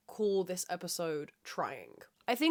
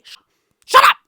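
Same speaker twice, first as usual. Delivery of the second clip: yelled; 21 dB louder than usual. Real, not acted. Sudden outburst at imagined critic.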